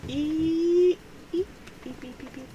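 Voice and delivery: high squeaky eep noises; tiny high-pitched eeping. Pretend. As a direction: squeak like a little creature. Squeaky noise while showing tattoo.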